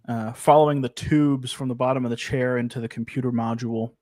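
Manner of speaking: normal talking voice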